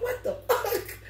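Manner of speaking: high, disbelieving what the fuck through laughter; shocked. Retold or imitated. Retelling the birthday story, a high laughing shocked squeal. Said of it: "I'm like".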